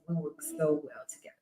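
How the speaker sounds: soft secret whisper. Pretend. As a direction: confide it under your breath. Confiding about alter ego.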